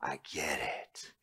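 slow hushed whisper; awed whisper. Retold or imitated. Preacher recalling his answer to God, slow awed whisper. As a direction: breathe it out in quiet awe. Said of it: "It was just like".

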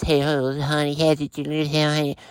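soft, gushing voice for a fluffy dog; cutesy pet talk. Shown as a demonstration. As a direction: coo it like the owners do. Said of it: "No, it's".

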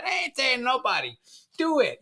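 high taunting "do it"; defiant dare. Retold or imitated. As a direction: goad him like a defiant little sister. Sister daring him to hit her.

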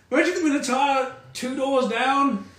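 tavern patron voice. Acted. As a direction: sneer it out like a drunk patron. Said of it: "some guy goes up to Bombros and goes".

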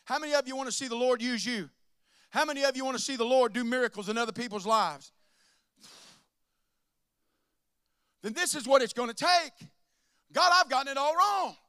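loud, high preaching at full force; fiery preaching. Acted. Pastor exhorting the congregation, loud full-force preaching.

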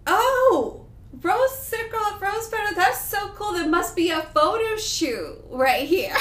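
high, loud mock-naive excitement; oblivious delight. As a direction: exclaim it high, cluelessly delighted. Retelling her oblivious reaction to petals.

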